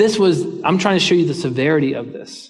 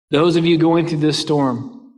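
ordinary talk

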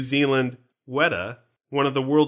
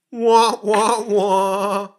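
mock deflating wah-wah fanfare, louder; sad trombone wah wah; 5 semitones higher, 5 dB louder, much slower than usual. Podcast host mocks the logo's letdown, mock wah-wah.

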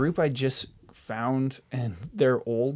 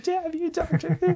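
mock anguish. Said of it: "I'm like".

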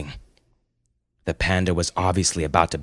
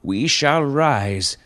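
slow, raised, grand oratory; yelled proclamation; 7 semitones higher, 4 dB louder than usual. Acted. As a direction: proclaim it to a vast crowd as a noble panda. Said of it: "he yelled".

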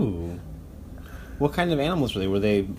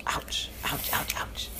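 soft unvoiced ouches; whispered ouch; 9 dB quieter, much slower than usual. Father acts being stung.

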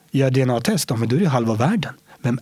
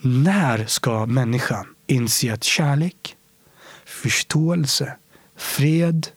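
slow pleading; much slower than usual. Real, not acted. Rhetorical plea, very slow.

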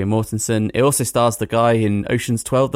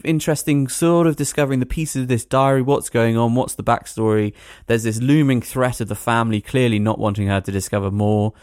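usual speaking voice

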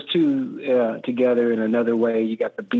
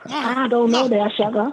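higher, slower hammed-up Southern belle; mock Rogue drawling "sugar", pitched high; Rogue impression; 10 semitones higher, slower than usual. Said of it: "your Rogue was wonderful".

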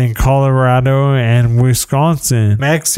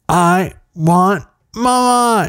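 mock child's scream; 6 semitones higher than usual. Dad imitating his daughter's night-time tantrum, mock screaming. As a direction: imitate a screaming kid in three slow, held notes. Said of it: "screaming out the front door".

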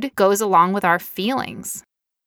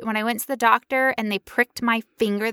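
usual speaking voice

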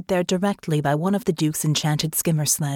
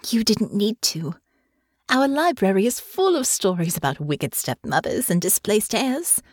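put-on higher, earnest young woman; sincere reassurance; 7 semitones higher than usual. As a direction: reassure him tenderly. Honor comforts father.

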